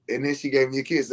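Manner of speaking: usual speaking voice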